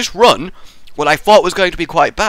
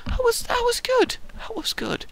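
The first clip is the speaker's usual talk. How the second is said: falsetto praise; 6 dB quieter than usual. Pretend.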